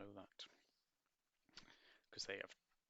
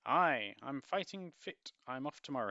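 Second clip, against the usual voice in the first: put-on proud young recruit; 5 semitones higher, 10 dB louder than usual. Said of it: "Stanley answers".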